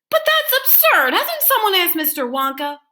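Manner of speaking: put-on high astonished child; incredulous boy. A narrator's reading. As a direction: scoff at the idea as a boy. Charlie protesting in story.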